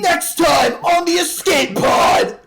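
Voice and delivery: announcer voice. Acted. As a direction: shout it like a show promo. Mock TV promo.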